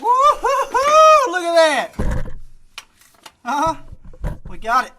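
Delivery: high, loud victory whoop; excited whoop. Real, not acted. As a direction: cheer like you just won. Bolt finally breaks free.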